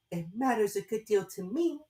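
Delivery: plain reading voice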